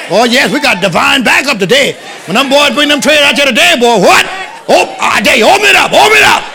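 loud, high pulpit shouting; full-force preaching. Real, not acted. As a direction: drive it home to the congregation, loud.

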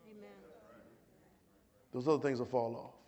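ordinary talk, nothing marked

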